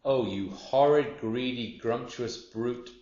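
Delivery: high, slow storybook outrage; scolding hippo, stretching the final words; indignant cry. A narrator's reading. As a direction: draw out each insult in acted horror. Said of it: "cried Humpy Rumpy".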